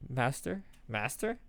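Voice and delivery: normal talking voice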